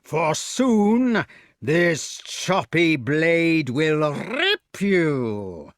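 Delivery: slow, sneering giant voice; menacing giant. Acted. Giant gloating over his prisoners, slow and sneering. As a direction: drag each threat out slowly.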